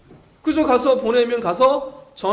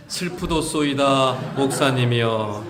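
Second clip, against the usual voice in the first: slow, low, stiffly solemn archaic answer; mock solemn reply; 7 semitones lower, slower than usual. Church member answering the pastor, mock solemn and slow. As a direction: drone it like a pompous old scripture line. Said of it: "이렇게 하십니까?".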